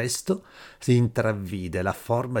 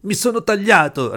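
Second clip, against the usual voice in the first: high, loud shriek of pain; 11 semitones higher, 8 dB louder than usual. A narrator's reading. Boy shrieking to the man after cutting himself. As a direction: cry out the words in sudden pain. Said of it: "rispose strillando".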